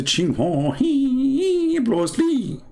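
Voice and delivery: high, drawn-out mock-Chinese syllables. Comedian pretending to read out a Chinese name, mock Chinese. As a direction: put on a fake Chinese, held high and stretched. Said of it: "this is my Chinese, by the way".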